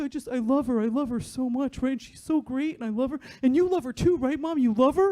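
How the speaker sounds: a hint of a lovestruck teen boy; imitating excited son. Mom voicing her son gushing about his girlfriend, excited. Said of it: "and he's like".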